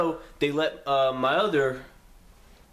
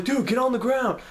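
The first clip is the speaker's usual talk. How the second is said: urgent; 9 semitones higher, 3 dB louder than usual. Retold or imitated. Friend urges narrator to comply.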